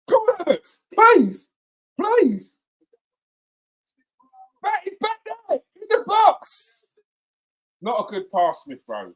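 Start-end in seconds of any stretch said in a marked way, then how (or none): 0.1-2.6 high, loud pleading at the screen; high, pleading cry; desperate pleading. Real, not acted. 4.6-6.8 urgent urging. Real, not acted. Urging player during attack.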